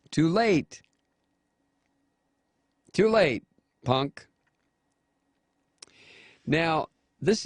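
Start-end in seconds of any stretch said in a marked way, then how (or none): 0.1-4.2 high, loud jab with falling end; scornful taunt. Real, not acted. Host taunts the senator for changing his word, scornful. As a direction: taunt him loudly, pitched up, word by word.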